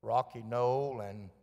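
ordinary delivery

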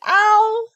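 loud high "Ow!"; high-pitched bumped yelp; coming-down cry. A narrator's reading. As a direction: yelp as if landing with a bump. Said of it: "as he came down".

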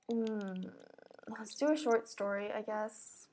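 ordinary talk

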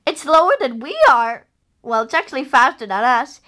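ordinary delivery

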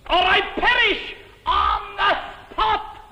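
high, loud hammed-up wail; melodramatic shout. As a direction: cry out your doom theatrically. Melodramatic threat to die.

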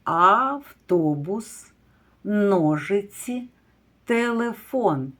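slow, drawn-out naming of each word; slow careful words for preschoolers; slow careful word list. Shown as a demonstration. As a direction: read each word slowly and clearly for small children. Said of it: "називаємо слово".

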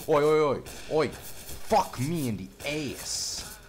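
high, loud oi calls then slow shouted curse; loud yell. Real, not acted. Gamer shouting in frustration at an enemy. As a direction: shout it at the screen.

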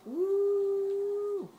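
very high, long held "woo"; loud whoop. Real, not acted. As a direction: let out a piercing sustained whoop. Cheers during the race.

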